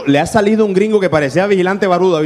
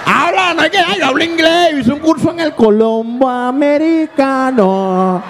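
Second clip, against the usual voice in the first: high, slow prissy parody of a language school; a high put-on voice bragging about English class; mock snooty voice; 10 semitones higher, slower than usual. (voz alta y remilgada, burlona)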